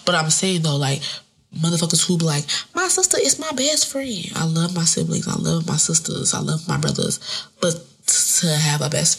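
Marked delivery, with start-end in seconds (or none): 2.7-4.2 high, gushing imitation of a proud sister; mock gushing. Mimicking people who brag about a sister, mock gushing. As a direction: imitate someone gushing, pitched up.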